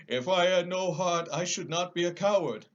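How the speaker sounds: a big cowardly lion protesting; lion, high and loud. Acted.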